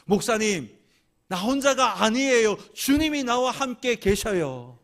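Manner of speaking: ordinary delivery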